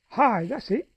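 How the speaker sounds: voiced Noah having a brainwave; sudden realisation. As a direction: pipe up as the idea strikes. Noah suddenly hits on the answer.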